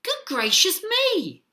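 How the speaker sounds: high, startled outcry; shocked exclamation. A narrator's reading. Mother looking into the water, shocked exclamation. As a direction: exclaim it in storybook astonishment. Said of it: "and exclaimed".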